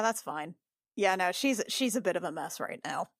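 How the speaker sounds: usual speaking voice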